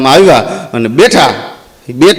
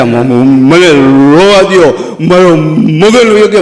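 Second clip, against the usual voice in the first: mock sobbing; 2 semitones lower, slower than usual. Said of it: "તો એ રોતા રોતા બોલ્યો".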